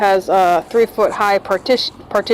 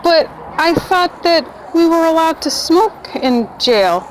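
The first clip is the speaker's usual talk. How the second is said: naive whine; 9 semitones higher, slower than usual. Retold or imitated. Naive question to booking officer.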